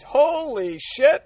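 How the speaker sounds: drawn-out slow, loud holy shit; stretched, stunned outburst; shocked exclamation. Real, not acted. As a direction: gasp it out in disbelief. Reacting to the letter.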